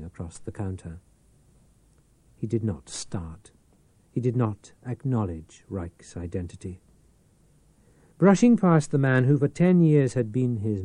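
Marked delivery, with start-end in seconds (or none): none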